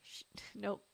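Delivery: usual speaking voice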